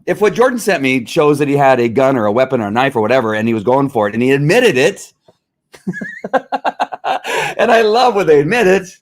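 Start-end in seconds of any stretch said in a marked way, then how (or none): 5.8-8.9 slow, higher, chuckling through the words; laughing gloat. Real, not acted. Host gloating to listeners about suspects who admit it, laughing. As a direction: savour it with a laugh.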